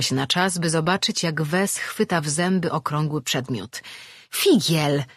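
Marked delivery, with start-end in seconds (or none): 4.3-5.0 a mild call across the room. A narrator's reading. Woman calling her runaway pet by name. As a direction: call the pet's name sharply. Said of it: "zawołała".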